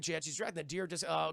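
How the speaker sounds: ordinary talk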